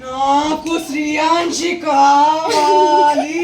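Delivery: slow, drawn-out sing-song ghost wail, loud; demanding ghost. Pretend. Ghost demands Sriyanshi for itself, in an eerie drawl. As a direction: stretch every word like a haunting spirit.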